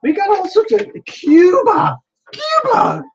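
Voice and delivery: very high, amazed squeal; thrilled, voice leaping way up; freaking out with excitement. Real, not acted. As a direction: squeal it in disbelieving delight. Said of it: "I'm freaking out here".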